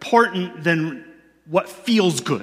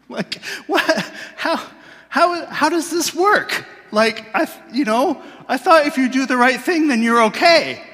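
baffled outrage; 9 semitones higher than usual. Retold or imitated. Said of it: "I'm like".

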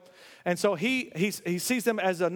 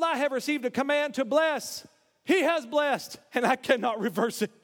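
a raised preaching voice, slowing on key words; 5 semitones higher than usual. Acted. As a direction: proclaim it with full force. Preaching the prophecy with force.

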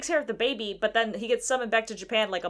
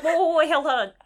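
alarmed plea; 4 semitones higher than usual. Retold or imitated. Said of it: "And we're like".

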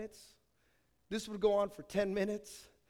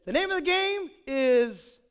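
louder, higher mock-grand announcement; game announcement; 4 semitones higher, 9 dB louder than usual. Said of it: "I said".

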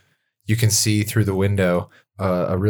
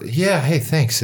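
bashful thanks; 7 semitones higher, slower than usual. Acted. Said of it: "elf writer thanked for her novel".

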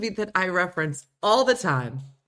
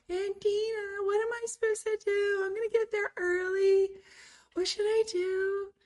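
much higher, whiny friend voice; imitating Natalia. Friend lost before Paris, mimicked in a high worried voice. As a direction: mimic a worried young friend asking for help. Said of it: "'cause that's how she sounded".

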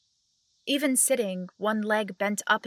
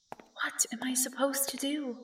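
soft, lost whisper; whispering to self; 3 semitones higher, 8 dB quieter than usual. Acted. Confused visitor asking himself, whispered. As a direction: whisper it helplessly to yourself.

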